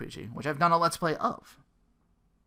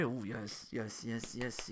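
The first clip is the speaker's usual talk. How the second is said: soft, breathy-sounding moaned yeses, much softer; mock ecstatic moan; 3 semitones lower, 10 dB quieter, slower than usual. Gamer swooning over a Yakuza-style Fatal Fury, soft mock moan. Hyped at the game announcement.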